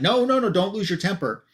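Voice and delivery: hurriedly head off someone's anger; mock appeasing voice in a retelling; placating. Villain placating an angry hero.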